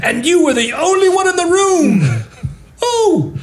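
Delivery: high, hammed-up radio-drama accusation; over-the-top squealed stage accusation; melodramatic accusation. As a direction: accuse them in a mock-dramatic high voice, laughing. Improvised melodramatic accusation scene.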